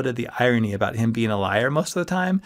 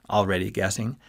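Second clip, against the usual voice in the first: ordinary delivery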